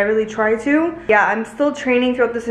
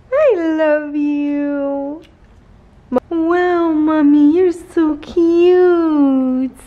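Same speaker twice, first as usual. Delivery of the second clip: high, slow cooing; 6 semitones higher, 4 dB louder, much slower than usual. Real, not acted. Owner cooing at her sleepy dog in high baby talk. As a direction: coo over a cute puppy.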